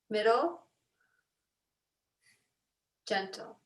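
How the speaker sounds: yoga teacher talking the class through alternate nostril breathing; guided breathing. Acted. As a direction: guide the breath out, easing down to near silence. Leads a breathing exercise.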